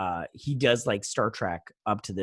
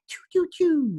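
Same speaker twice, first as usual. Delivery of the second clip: high, punchy pew-pew noises, louder; mock gun sounds; 4 dB louder than usual. Retelling the crew firing back, mock gun sounds. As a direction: fire off toy-gun sounds in a squeaky voice.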